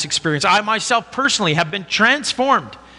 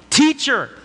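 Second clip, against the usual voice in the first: a higher, lightly raised call of recognition; mild mock yell; 9 semitones higher than usual. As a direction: call out in joyful surprise, understated. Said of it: "Mary yells out".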